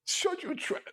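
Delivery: high, slow mock British accent; bad British accent. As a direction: parrot the bad accent in a squeakily high pitch. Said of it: "doing a bad impersonation of a bad accent".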